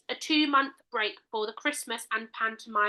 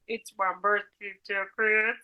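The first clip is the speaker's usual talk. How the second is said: Mister Bean; 3 semitones lower than usual. Acted. Co-host joining the duet, in comic character voice. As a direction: sing along as the odd comic character. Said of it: "duet between Kermit and Mister Bean".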